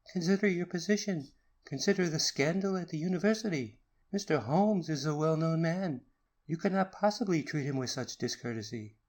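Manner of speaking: high, pressing appeal to calm; urgent pleading. A narrator's reading. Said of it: "he cried".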